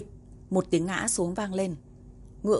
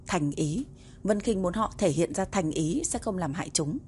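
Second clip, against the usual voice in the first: usual speaking voice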